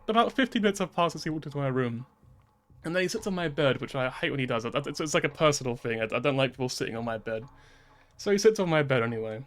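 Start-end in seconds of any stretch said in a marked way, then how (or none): none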